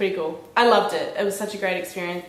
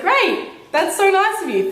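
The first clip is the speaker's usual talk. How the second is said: high, loud sugary thank-you; mock sweet gratitude, much higher; sweet fake thanks; 11 semitones higher, 6 dB louder than usual. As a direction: gush sweetly as if batting your eyelids. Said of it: "batted my eyelids".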